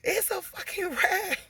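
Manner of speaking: high voice with a laugh breaking in; kid yelling. Retold or imitated. A kid confirming the rat to mom, high with a laugh. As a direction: blurt it out, half laughing. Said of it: "somebody was like".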